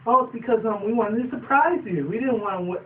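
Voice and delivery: high, hurried cover story; nervous excuse. Retold or imitated. Caught ponies making an excuse, high voice. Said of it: "It's like".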